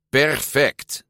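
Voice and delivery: proud. A narrator's reading. Alberto admires his battered Vespa as parts fall off, proudly. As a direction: say it with satisfied pride. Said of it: "zei Alberto trots".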